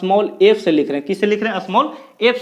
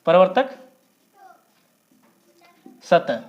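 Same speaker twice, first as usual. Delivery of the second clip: वक्रता and त्रिज्या spoken apart, a long pause between; word by word for note-taking; slow dictation; 2 semitones lower than usual. Shown as a demonstration.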